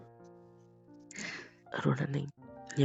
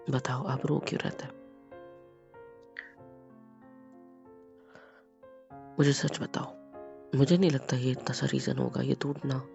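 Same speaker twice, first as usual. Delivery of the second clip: softer, lower tender asking; gentle pleading; 3 semitones lower, 6 dB quieter than usual. Acted. Husband softly asking his wife why she cries. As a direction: coax the truth out gently.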